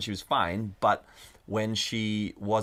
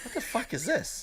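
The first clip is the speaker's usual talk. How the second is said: laughing disbelief; 12 semitones higher, 4 dB quieter than usual. Real, not acted. Shocked at own bleeding.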